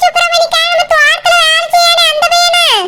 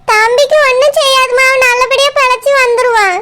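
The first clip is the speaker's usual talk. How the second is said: ordinary talk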